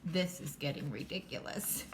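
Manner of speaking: lightly voiced low cat; low, unimpressed cat remark; a hint of a fed-up cat; story cat. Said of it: "And the cat says".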